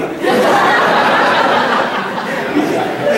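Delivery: long airy unvoiced laugh; breathless giggle; breathy laugh. Real, not acted. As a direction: laugh out of pure disbelief. Laughing at her own surprise.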